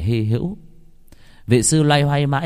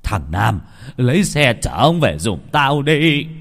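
louder, drawn-out gruff order; angry shout; 3 semitones higher, 4 dB louder, slower than usual. A narrator's reading. Old husband orders Nam to take the monk away, shouting. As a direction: shout the order, stretching the words. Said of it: "Ông hai quay qua quát".

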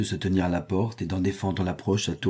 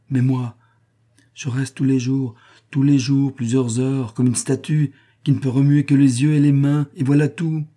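louder, higher, building grievance; heated complaint; 5 semitones higher, 5 dB louder than usual. A narrator's reading. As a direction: bemoan the same dull task, insistently. Servant heatedly bemoans her job.

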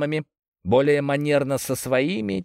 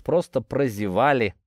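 usual speaking voice

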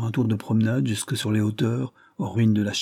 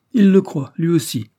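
emphatic retort; 7 semitones higher, 7 dB louder than usual. Acted. Sophie mocks Ravensbird's blindness.

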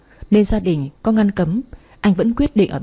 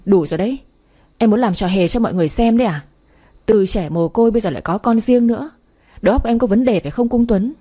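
ordinary delivery, nothing marked

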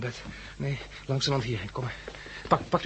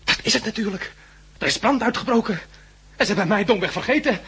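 higher, louder, nervous laugh breaking in; rising panic; 8 semitones higher, 5 dB louder than usual. Acted. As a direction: panic building as he imagines fire. Patient panics about a fire.

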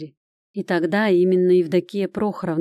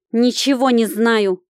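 loud, higher, drawn-out retort; snappish; 4 semitones higher, 6 dB louder, slower than usual. A narrator's reading. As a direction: snap back defensively. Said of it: "огрызнулась Прохоровна".

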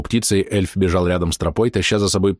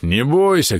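shouting; 5 semitones higher, slower than usual. A narrator's reading. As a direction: shout into the ground. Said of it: "крикнул в землю Бом".

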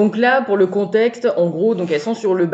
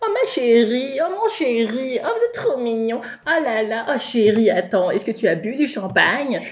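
gushing, higher mock socialite voice; hammed-up darling-this, darling-that chatter; Christina Cordula; 4 semitones higher than usual. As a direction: imitate women fawning over each other. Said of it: "un petit côté Christina Cordula".

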